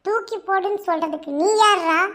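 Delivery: angry outburst building to loud; indignant anger. Acted. Lover telling friend off for insulting his beloved, indignant anger. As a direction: build each phrase to an outraged challenge.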